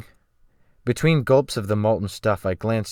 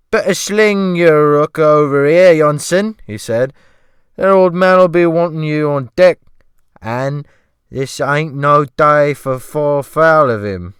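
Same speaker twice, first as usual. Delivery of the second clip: loud, high servile cook voice; fawning sea cook, pitched up and loud; ship's cook; 6 semitones higher, 10 dB louder than usual. Acted. The cook asking the rescued man, in a loud high fawning voice. Cook calls Johnson away.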